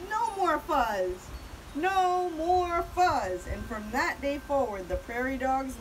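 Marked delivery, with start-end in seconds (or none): 0.1-3.5 slow, high crowd chant; yelling. A narrator's reading. Crowd swearing off fuzz, chanting loudly. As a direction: stretch each word, building louder. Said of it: "the crowd yelled".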